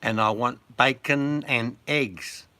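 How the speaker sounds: very loud, slow demand; loud slow English tourist. Retold or imitated. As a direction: bellow it slowly like a tourist abroad. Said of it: "really loudly".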